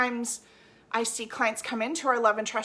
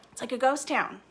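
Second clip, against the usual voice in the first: ordinary talk, nothing marked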